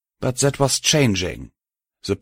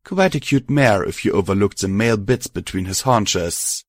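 ordinary delivery, nothing marked

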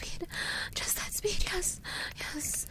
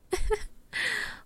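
giggle; 10 semitones higher than usual. Acted. Playful laugh after teasing.

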